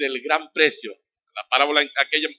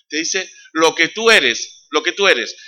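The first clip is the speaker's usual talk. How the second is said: "tú" leaned on, slow and louder; stress on tú; 4 semitones higher, 9 dB louder, slower than usual. Real, not acted. Preacher to listener, slow stress on "tú". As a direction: press the word "tú" slowly.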